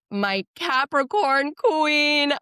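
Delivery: high, drawn-out praise; high, slow fond gush. Real, not acted. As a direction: adore her out loud. Gushing over Sierra.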